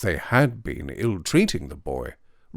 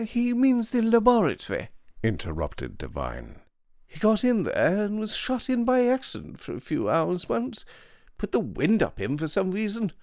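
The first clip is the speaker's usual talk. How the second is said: languid upper-class gent; 13 semitones higher than usual. Acted. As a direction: play him smug and breezy. Devine's voice.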